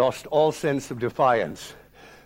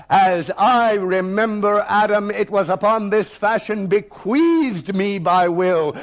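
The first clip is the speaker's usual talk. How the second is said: thunderous defiant reading; 5 semitones higher than usual. Retold or imitated. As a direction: declaim it with full defiance. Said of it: "I read with thunder and assurance and command".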